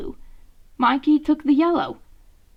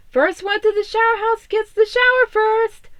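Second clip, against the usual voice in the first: higher, louder competitive call; playful challenge; 10 semitones higher, 6 dB louder than usual. Acted. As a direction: shout the dare before sprinting. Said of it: "Raph said as he pushed off Mikey".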